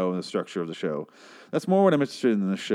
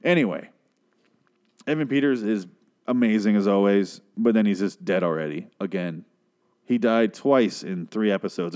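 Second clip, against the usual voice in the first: usual speaking voice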